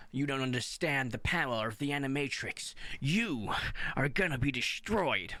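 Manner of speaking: hammy over-the-top villain voice; dramatic English dub. Retold or imitated. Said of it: "if I'm gonna hear".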